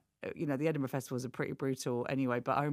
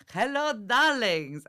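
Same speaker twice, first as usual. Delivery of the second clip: slow, higher and louder camp hostess greeting; Greek character voice; 6 semitones higher, 6 dB louder, much slower than usual. Retold or imitated. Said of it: "I went".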